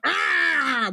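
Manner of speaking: high sudden yelp on waking; startled cry. A narrator's reading. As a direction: jolt awake with a cry. Devil woken with a start.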